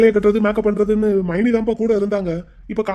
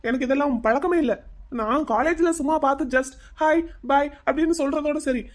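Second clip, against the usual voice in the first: raised pitch, uneasy pleading; nervous; 5 semitones higher than usual. Acted. Young man excuses himself over girl's visit, nervous. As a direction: explain yourself with jittery unease. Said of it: "நர்வஸா இருக்கு".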